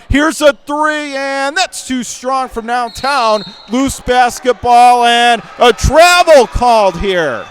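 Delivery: excited call. Real, not acted. Three-point attempt and turnover.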